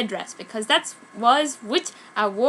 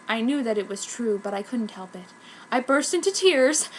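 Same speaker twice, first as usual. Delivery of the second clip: ordinary delivery, nothing marked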